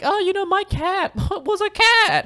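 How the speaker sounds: very high, slow, loud passenger impression; hammed-up high-pitched small talk; mock chatty passenger. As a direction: squeal it slowly like a gushing passenger. Mimicking an overly chatty passenger.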